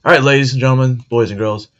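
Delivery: loud show-host announcement; announcer intro. Acted. Host announcing the next bit.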